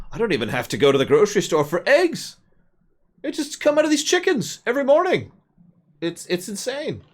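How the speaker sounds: very high, strained put-on voice of an amazed farmer; high comic voice marvelling that food just grows; scam-busting farmer. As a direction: squeak it out like a man who thinks farming is a con. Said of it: "he's acting as though farming is a big scam".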